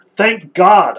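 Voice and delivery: loud, high cry of thanks; exultant shout. A narrator's reading. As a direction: read it as a shouted exclamation. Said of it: "I shouted".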